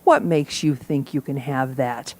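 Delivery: a hint of a scolding, doubtful inner voice; inner critic voice. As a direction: say it like a nagging little voice in the head. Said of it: "this little voice inside me that says".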